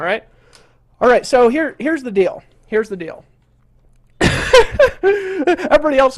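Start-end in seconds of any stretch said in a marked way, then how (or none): 4.2-5.0 loud high exasperated laugh; burst of laughter. Real, not acted. Laughs after scolding heckler. 5.0-6.2 said through a laugh, louder. Real, not acted. Laughing after scolding heckler.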